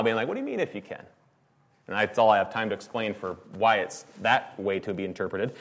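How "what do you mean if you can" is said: 'What do you mean, if you can?' higher and softer, with an audible smile; twinkle in his eye. Shown as a demonstration. Jesus gently teasing the doubting father, smiling. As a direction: tease him gently, smiling and winking. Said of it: "twinkle in his eye with a little bit of a smile".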